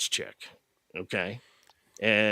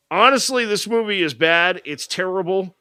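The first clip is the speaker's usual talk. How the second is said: higher and louder honest verdict; emphatic verdict; 6 semitones higher, 6 dB louder than usual. Real, not acted. Giving the rating with a loud, high outburst. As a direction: burst out with the verdict.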